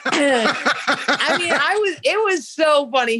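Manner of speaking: high-pitched, laughing through the words. Real, not acted. Telling a friend's fight story, cracking up laughing. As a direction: crack up while talking, voice riding high.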